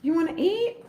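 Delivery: high sing-song voice to the cat; pet talk. Real, not acted. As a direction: coax the cat up high and sweet. Asking the cat.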